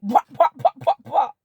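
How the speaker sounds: loud, high "pa pa pa" bursts; imitated gunfire mouth. Wife showing how mouthy she became, loud popping bursts. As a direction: pop each "pa" out like shots.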